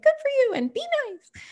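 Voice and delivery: high sing-song praise. Retold or imitated. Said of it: "We're kinda like".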